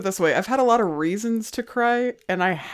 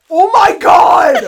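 much higher and louder, slow shout then laugh; overwhelmed shout; 14 semitones higher, 11 dB louder, much slower than usual. Real, not acted. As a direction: cry out at an overwhelming gift. Unwraps the big rock gift.

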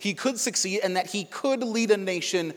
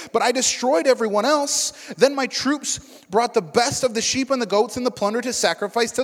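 Saul pushing back, louder and higher; insisting; 6 semitones higher, 6 dB louder than usual. A narrator's reading. Saul insisting to Samuel that he obeyed, raised and pressing. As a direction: defend yourself, pressing each point. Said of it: "Saul insisted".

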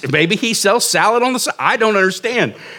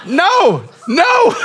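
rising panicked no; flustered no; 11 semitones higher than usual. Retold or imitated. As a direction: turn it down in a fluster. Refusing the sale.

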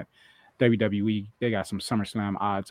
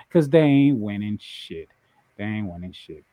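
normal talking voice

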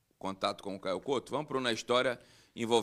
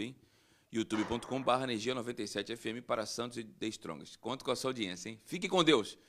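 radio announcer; 5 dB louder than usual. Acted. Radio host closing announcement.

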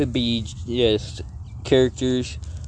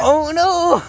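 squeaky alarm; 13 semitones higher, 5 dB louder than usual. Pretend. Mock alarm, high pitched.

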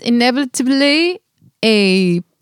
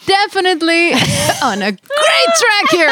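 laughing; 7 semitones higher than usual. Real, not acted. Joking about failed relationships.

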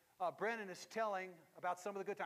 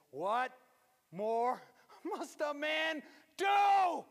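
loud, high, slow, half-laughing shout; retold shout; 9 semitones higher, 12 dB louder, much slower than usual. Preacher retelling mother's scolding in a loud laughing shout. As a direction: bellow it again, laughing through it.

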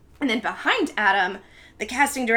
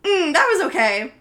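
raised, louder mock-dismissive verdict; douchey director; 7 semitones higher, 6 dB louder than usual. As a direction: lightly imitate an unimpressed director. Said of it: "director's like a douche".

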